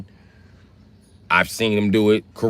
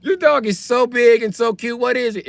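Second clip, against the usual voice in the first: very high, loud falsetto squeal; mock a dog lover cooing in a squeaky falsetto; 5 dB louder than usual. Dog lover who still likes dogs, hammed-up gushing falsetto. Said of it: "She'd be like".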